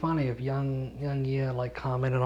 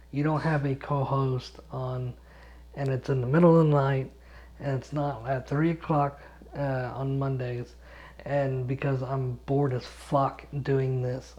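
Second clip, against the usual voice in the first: ordinary delivery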